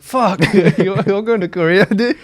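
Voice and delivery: incredulous, laughing through it; laughing disbelief. Retold or imitated. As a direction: laugh while asking in disbelief. Retelling own reaction, laughing.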